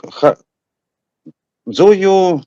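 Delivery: ordinary talk, nothing marked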